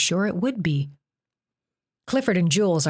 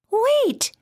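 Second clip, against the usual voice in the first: much higher, urgent little weasel cry; 4 dB louder than usual. A narrator's reading. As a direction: stop the monster in a squeaky animal voice. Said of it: "the weasel said".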